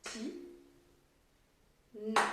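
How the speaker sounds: "Ti-na" split by a long pause, each syllable short; "Ti", wait, then "na". Shown as a demonstration. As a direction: say "Ti-na" as two separate beats for counting. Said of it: "Je refais une dernière fois".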